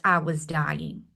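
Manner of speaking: slow, quiet, choked-up words; emotional moment. Real, not acted. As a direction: voice catching as the memory hits. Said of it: "sorry, I had a little emotional moment".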